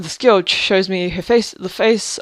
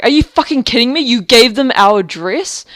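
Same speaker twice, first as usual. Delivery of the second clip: raised, louder outraged disbelief; retold outraged disbelief; 4 semitones higher, 5 dB louder than usual. Confronting the housemate about the address, outraged disbelief. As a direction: recreate the shock of hearing she gave out the address. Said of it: "And I'm like".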